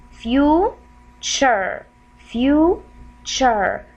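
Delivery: "future" broken in two, "few" rising, then "chur"; "future" sounded out slowly with a gap before "chur"; slow syllable split. Shown as a demonstration. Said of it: "pronounce it in a slow way".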